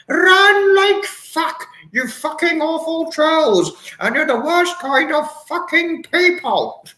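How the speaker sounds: very high, drawn-out mock roar; hammed-up, slow celebrity bellow; Brian Blessed. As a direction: stretch the opening words into a huge high cry. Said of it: "He'd probably say something like that".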